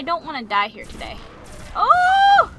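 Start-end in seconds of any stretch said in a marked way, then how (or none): 1.7-2.5 loud high-pitched cry of alarm; sudden loud yelp on being hit; high startled yelp. Real, not acted. Hit in fight.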